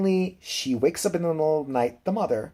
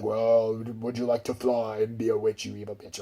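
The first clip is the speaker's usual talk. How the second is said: put-on devil-goat voice, trailing softer; creepy talking goat; 3 semitones lower, 4 dB quieter than usual. Devil goat offers the girl witchhood, creepy mock voice. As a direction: tempt her as a creepy goat. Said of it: "but it sounded really creepy".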